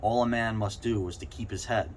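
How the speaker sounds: usual speaking voice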